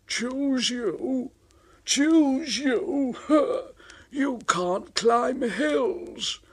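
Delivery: high, mocking scorn; scoffing Henry. Henry mocking James's chances. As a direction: sneer at the rival.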